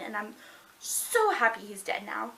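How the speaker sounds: ordinary delivery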